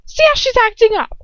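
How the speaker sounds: mock the girl with contempt; Bellatrix sneer. A narrator's reading. Bellatrix scorns the swaying girl, high and sneering. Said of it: "Bellatrix asks".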